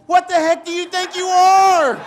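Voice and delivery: high, loud and slow outraged dad; mock outraged yell. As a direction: yell it at your son in disbelief. Dad yells at son.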